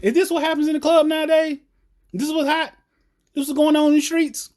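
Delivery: high-pitched incredulous questioning; baffled disbelief. Real, not acted. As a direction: ask it high, totally baffled. Baffled by dancing in a video.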